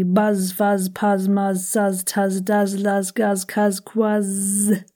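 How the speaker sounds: brisk, even monotone run; quick drill. Shown as a demonstration. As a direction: rattle the buzzing words off quickly. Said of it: "Do those quickly".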